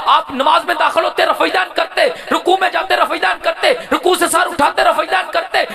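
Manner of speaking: high, loud, driving sermon delivery; impassioned high-pitched preacher; full-force preaching. Real, not acted.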